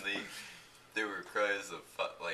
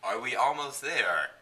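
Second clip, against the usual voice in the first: louder, higher, slow call; shouting; 5 semitones higher, 7 dB louder than usual. Acted. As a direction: shout it up the path. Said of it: "shouted Harry Potter".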